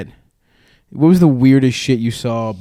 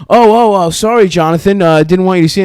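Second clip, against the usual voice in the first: loud, higher fake apology; mock flustered; 6 semitones higher, 6 dB louder than usual. Players pretending Jonathan caught them, flustered. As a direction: blurt it out as if caught.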